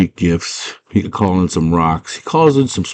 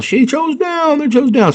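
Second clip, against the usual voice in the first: lightly sung-out, much higher; mock high announcement. Teller mocks the labyrinth announcing she chose down, squeaky high.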